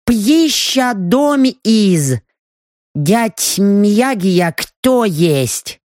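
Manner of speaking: slow, halting elf translator. Acted. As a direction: reach for foreign words slowly. Elf translator, broken speech.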